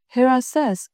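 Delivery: plain reading voice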